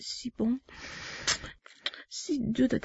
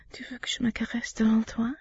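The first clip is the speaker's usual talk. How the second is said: quick, hushed tease; teasing whisper; 5 dB quieter, faster than usual. Acted. Teasing her partner, fast whisper. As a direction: whisper the question fast and playful.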